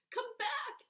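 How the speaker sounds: put-on boy yelling for the plane to return. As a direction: call after it. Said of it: "yells Fred".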